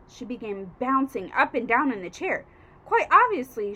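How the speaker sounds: ordinary delivery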